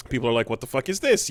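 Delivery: normal talking voice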